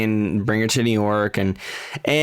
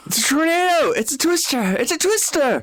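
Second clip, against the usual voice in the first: much higher hammed-up alarm; excited mock shout. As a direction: cry it out like a frantic storm chaser. Playful movie quote shouting.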